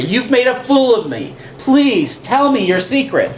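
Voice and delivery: mock Delilah pleading. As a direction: play the wounded, demanding lover. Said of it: "Delilah's angry".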